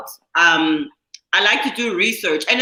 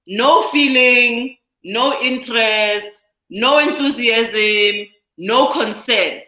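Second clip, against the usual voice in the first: each item slow and separate; slow emphatic list; much slower than usual. Real, not acted. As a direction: spell out every no slowly and deliberately. Defining apathetic word by word.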